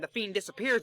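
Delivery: usual speaking voice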